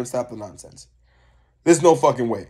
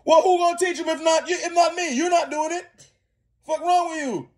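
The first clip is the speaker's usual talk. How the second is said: imagined outburst; 11 semitones higher than usual. Retold or imitated. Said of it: "I'd be like".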